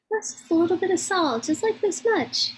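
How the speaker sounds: high-pitched, breezy cooking instruction; Afghan parent. Retold or imitated. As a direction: imitate an Afghan parent waving away measurements. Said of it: "taught cooking by an Afghan parent".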